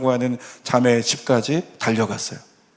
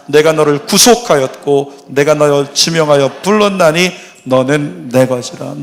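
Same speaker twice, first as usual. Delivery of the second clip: louder, proclaiming recitation; congregational scripture reading; 6 dB louder than usual. Real, not acted. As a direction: read the verse aloud together as one. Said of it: "같이요, 시작".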